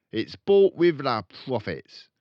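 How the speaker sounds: loud, high, blustering dad; angry father. Acted. Father snapping at Matilda, loud and angry. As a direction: bark it back at her.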